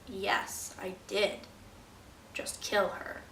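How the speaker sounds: low, hushed mock admission; subdued confession. Othello admits the killing to Emilia, subdued confession. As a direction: own up softly, voice dropped and halting.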